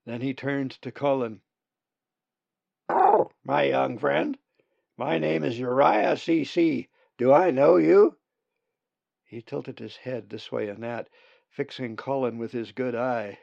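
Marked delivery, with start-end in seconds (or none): 2.9-8.2 put-on a creaky, grand old raven greeting a stranger. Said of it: "in a raspy voice".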